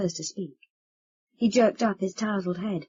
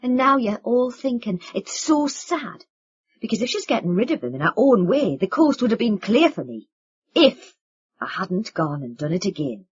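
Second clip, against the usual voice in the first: bitter sarcasm; 3 semitones higher, 6 dB louder than usual. Acted. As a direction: sneer at their pity.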